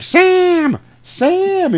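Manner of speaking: high, loud mock scream for help; hammed-up frantic mother's call; panicked calling. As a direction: shriek the name across the yard. Mother calls son for help.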